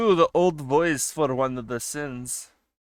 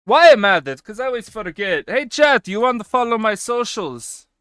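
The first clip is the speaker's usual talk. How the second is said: frustrated yelling; 5 semitones higher, 11 dB louder than usual. Real, not acted. Frustrated at typing command.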